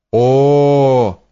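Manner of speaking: long steady wailing cry; acted howl held on one note; drawn-out howl. A narrator's reading. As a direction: stretch one vowel into a distant howl. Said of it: "раздался протяжный вой".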